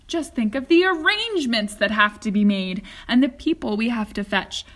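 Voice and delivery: loud, bubbling showman's voice; a delighted host rushing everyone along; excited chocolatier in a hurry; eager Wonka. Acted. Wonka greets Charlie excitedly.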